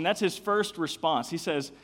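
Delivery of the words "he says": usual speaking voice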